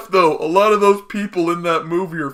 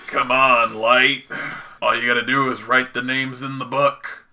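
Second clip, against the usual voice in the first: a hint of a scheming villain; lightly voiced tempting Ryuk; Green Goblin voice; 4 semitones lower than usual. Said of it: "his Green Goblin voice".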